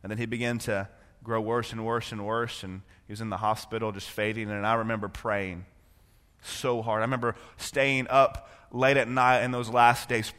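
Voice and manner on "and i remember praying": ordinary talk, nothing marked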